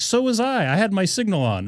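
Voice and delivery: high, indignant mock voice of the other driver. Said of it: "He's like".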